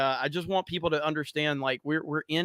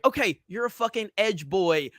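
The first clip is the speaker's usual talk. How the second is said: ordinary delivery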